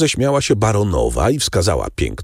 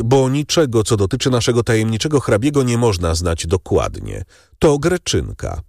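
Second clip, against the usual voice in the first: lightly voiced Albert; 8 semitones higher than usual. A narrator's reading. Albert explains about the count.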